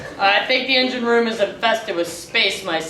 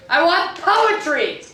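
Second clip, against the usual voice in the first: high demanding cry; 8 semitones higher than usual. Acted. Captain's demand.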